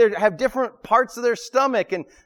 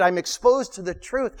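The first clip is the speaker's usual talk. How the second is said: ordinary talk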